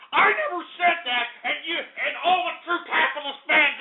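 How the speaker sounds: high indignant protest; indignant denial. Acted. Host repeats denial.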